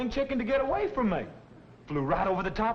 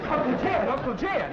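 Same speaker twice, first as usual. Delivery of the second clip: calling out; 4 semitones higher, 4 dB louder than usual. Acted. Running back, calling out.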